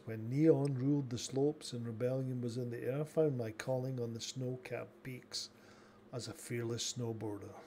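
soft, low storyteller voice; story narration. Acted. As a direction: tell it like an epic tale. Reads an epic life tale.

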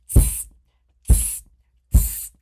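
loud sharp tss; loud monkey tsking. Acted. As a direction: kids join in, tsk loudly. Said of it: "and said".

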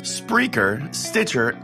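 normal talking voice

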